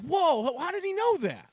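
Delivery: high-pitched retold astonishment; imitated surprise, pitch jumping up; startled reaction. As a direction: act out being caught off guard. Retelling own startled reaction while watching.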